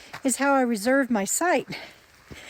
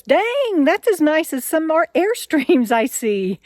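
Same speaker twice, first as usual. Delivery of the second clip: retold amazement, pitched up and louder; impressed surprise; 6 semitones higher, 5 dB louder, slower than usual. Officer peeks inside her RV and is impressed, retold surprise. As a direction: imitate an impressed officer, high and loud on "Dang".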